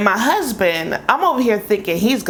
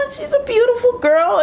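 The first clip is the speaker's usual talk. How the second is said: worried protective mom; faster than usual. Retold or imitated. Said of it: "I've seen some dudes".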